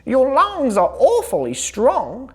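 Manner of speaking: high bright sheep; chirpy sheep invites bear; cheerful sheep voice. A narrator's reading. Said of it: "said Sheep".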